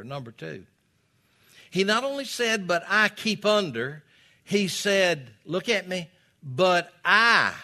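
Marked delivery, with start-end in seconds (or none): 1.7-6.1 loud, high preacher's exhortation; full-force preaching. Acted. 6.4-7.5 "I" punched loud and drawn out; stress on I. Shown as a demonstration. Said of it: "That's the emphasis of the phrase this time".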